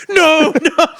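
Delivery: high, loud shouted protest; outraged producers. Acted. Producers cutting off a dating show contestant, mock outraged shout. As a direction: cry out in mock alarm to halt it.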